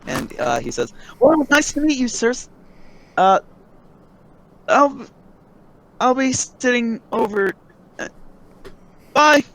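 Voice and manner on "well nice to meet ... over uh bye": way up high, cheery farewell; high NPC voice. Acted. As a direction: pitch the NPC up high and light.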